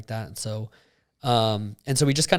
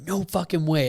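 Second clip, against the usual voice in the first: retold scream; 6 semitones higher than usual. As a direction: mildly re-enact an excited scream. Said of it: "I literally screamed".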